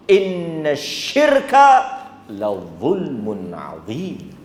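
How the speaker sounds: slow intoned verse with translation; Quran recitation. Acted. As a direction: recite Luqman's advice slowly. Recites Luqman's advice. (bacaan nasihat Luqman perlahan)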